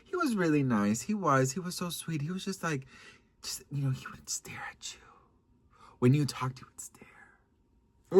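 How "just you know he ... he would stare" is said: slow, hushed spooky whisper; creepy whisper. Real, not acted. Describing the staring date, whispered.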